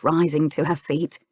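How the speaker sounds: plain reading voice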